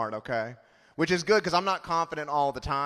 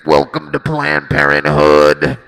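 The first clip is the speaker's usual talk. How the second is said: voice box greeting; 13 dB louder, slower than usual. Retold or imitated. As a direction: talk through an electrolarynx. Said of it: "a guy with a voice box".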